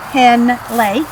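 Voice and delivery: ordinary delivery, nothing marked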